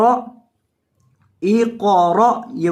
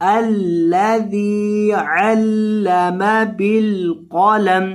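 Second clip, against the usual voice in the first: slow melodic tilawat; Quranic recitation; much slower than usual. Shown as a demonstration. Teacher reciting the whole verse for students, chanted.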